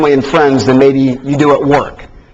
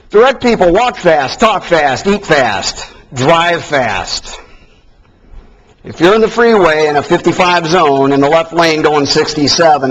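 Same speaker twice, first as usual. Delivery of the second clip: usual speaking voice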